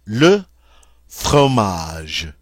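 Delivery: "Le fromage" with a French uvular r, "fʁomaʒ"; teacher demo of "Le fromage"; French model word. Shown as a demonstration. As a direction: drill "fromage" with a throaty French r. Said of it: "that's how you say".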